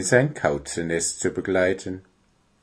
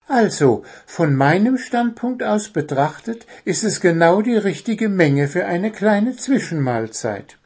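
high, lively bird voice explaining at length; higher-pitched, opinionated bird; stubborn little bird; 10 semitones higher than usual. Acted. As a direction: argue your case as a small cheeky bird. Voices Zwitschi explaining his viewpoint.

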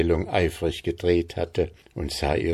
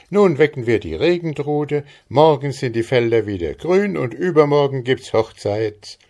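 high, jubilant rush of hope; joyful exclaiming; 7 semitones higher, 4 dB louder than usual. A narrator's reading. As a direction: announce it bursting with joy. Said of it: "rief Maren".

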